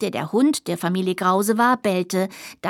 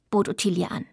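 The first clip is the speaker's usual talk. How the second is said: ordinary delivery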